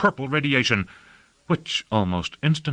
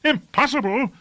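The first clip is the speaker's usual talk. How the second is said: high-pitched outraged echo; high, indignant questioning cry; incredulous cry. A narrator's reading. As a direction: throw the word back in disbelief, pitch way up. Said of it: "cried Lord Fayed".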